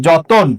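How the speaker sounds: যতন with the middle vowel rounded to o; o-sound pronunciation. Shown as a demonstration. Teacher shows how ত takes an o, pronunciation demo. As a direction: say যতন as jo-ton, o after the t. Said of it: "এই ত এর অটা উচ্চারণে ও হয়ে যাবে".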